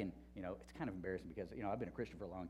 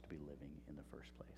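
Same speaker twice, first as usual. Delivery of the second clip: breathless whispered reveal of wisdom; hushed whisper; 8 semitones lower, 13 dB quieter than usual. Retold or imitated. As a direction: whisper the answer quickly, almost secret. Ends the quoted answer.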